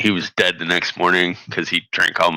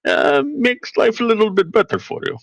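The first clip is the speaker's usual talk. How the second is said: put-on Russian accent, pitch climbing toward the end; 6 semitones higher than usual. Guest tries a Russian accent on western friends. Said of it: "my Russian accent".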